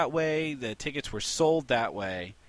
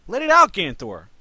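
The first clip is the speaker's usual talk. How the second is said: urging; 5 semitones higher, 11 dB louder than usual. Real, not acted.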